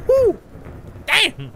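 high, loud cheer; excited whoop. Real, not acted. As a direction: whoop with delight. Excited about biscuits.

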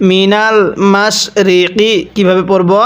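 normal talking voice

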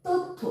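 তথ্য said as "tottho", with a doubled th; high, careful তথ্য with the th held double; clear pronunciation demo. Shown as a demonstration. Teacher demoing the word to pupils, careful and clear. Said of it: "থয় জফলা".

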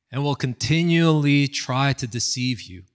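normal talking voice

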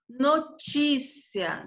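NOTÍCIA said slowly, TI stretched; stressed syllable drawn out. Shown as a demonstration. Teacher showing the class the strong syllable, stress drawn out. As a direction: lean long on the TI in notícia. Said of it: "penúltima sílaba mais forte".

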